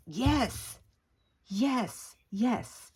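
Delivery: high excited yes. Real, not acted. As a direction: cheer it, thrilled. Excited at video views.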